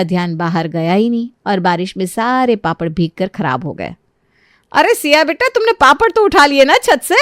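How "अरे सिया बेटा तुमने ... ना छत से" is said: raised, higher voice calling across the house. Acted. Aunt calls up to daughter-in-law about the papads, calling loudly. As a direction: call out to her so she hears.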